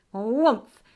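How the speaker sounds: loud, sudden "woomph"; tongue-flick sound effect. Retold or imitated. Describing the woodpecker in the tree, sound effect. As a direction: burst out a whoomphing noise. Imitates tongue shooting out again.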